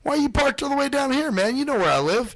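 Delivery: high-pitched retold complaint; giving him shit. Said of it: "I started giving him shit".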